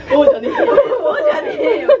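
high, loud laughing protest; laughing, shouting no way; laughing retort. Real, not acted. Laughing retort to silly idea. (笑いながらツッコミ)

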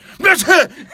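loud, high mock sneeze right in someone's face; imitated sneeze. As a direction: burst out a big fake sneeze. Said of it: "ele faz". (espirro imitado, alto e agudo)

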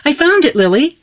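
high, loud, eager squeak; little girl squeals her find to her sister; squeaky little sister. A narrator's reading. Little sister squeaks to Lily, high and loud. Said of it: "she squeaked".

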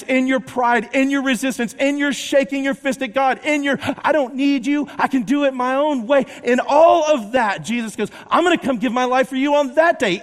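loud, driving sermon delivery; full-force preaching. Acted. Preacher rousing the congregation about Jesus, full-force preaching. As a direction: preach it out to the whole room.

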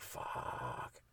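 a whispered, breathless resigned curse; whispered doomsday curse. Pretend. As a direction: hiss it under your breath. Resigned reaction to apocalypse.

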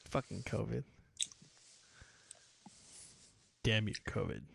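low, very soft grumble; muttered mock curse. Host cursing covid after the joke, low mock mutter. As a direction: curse it under your breath, mock-bitter.

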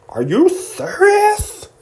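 very high, drawn-out fangirl squeal; mock fan excitement. Host playing fan told [name] is near, mock-excited squeal. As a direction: squeal it in pretend disbelief. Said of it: "Be like".

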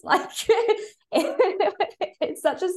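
amused, laughing as you speak. Real, not acted. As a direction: laugh while getting the word out. Laughs at neighbours returning her keys.

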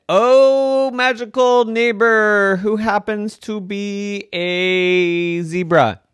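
high, loud pleading pig. Acted. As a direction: call out eagerly to the zebra. Pig addressing the zebra.

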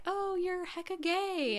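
high, drawn-out mock announcement; sing-song quiz verdict. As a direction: deliver it slowly, way up high, as a teasing quiz result. Voicing online quiz's verdict.

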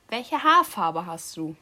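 ordinary talk, nothing marked

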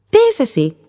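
high, startled question; frightened little star. A narrator's reading. Little star asks the dolphin in fright, high and startled. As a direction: ask it scared, like a small girl clinging on. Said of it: "ρώτησε έντρομη η αστερίτσα".

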